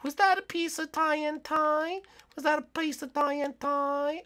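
high, stretched teasing question; mocking taunt. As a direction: sing it out to rub it in. Mocking a trapped player.

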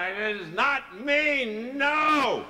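high, very loud, drawn-out denial; frantic refusal. Retold or imitated. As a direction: shout it slowly, refusing with everything you have. Acted panicked refusal.

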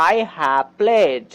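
very slow, stretched out; slow model sentence. Shown as a demonstration. Teacher slowly modelling the English example. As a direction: lay each word down for learners. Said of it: "যেমন".

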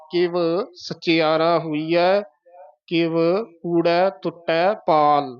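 slow, small pause after each ਕਿਵ; slight breaks. Shown as a demonstration. Teacher modelling the whole line, slow with brief pauses. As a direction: recite slowly, setting ਕਿਵ apart. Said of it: "ਯਮਕ ਲਾ ਕੇ ਬੋਲਣਾ ਹੈ".

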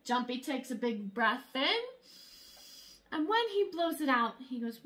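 ordinary delivery, nothing marked

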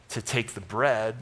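ordinary delivery

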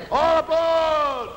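long, high, loud drawn-out shout; train conductor. Acted. Conductor calls passengers aboard for the fight tour, long shout. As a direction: holler it across the platform.

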